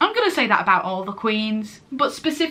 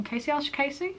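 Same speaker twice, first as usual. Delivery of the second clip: softly questioning, higher pitch; unsure pronunciation; 5 semitones higher, 8 dB quieter, faster than usual. Real, not acted. Reviewer fearing she got the name wrong, hesitant. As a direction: ask it quietly, voice lifting. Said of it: "I may have pronounced that wrong".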